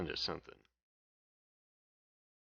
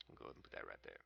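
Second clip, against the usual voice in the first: hushed mutter; 10 semitones lower, 17 dB quieter, much faster than usual. Real, not acted. Repeats the placement line, hushed.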